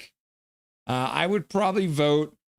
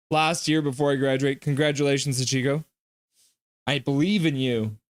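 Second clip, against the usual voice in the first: ordinary talk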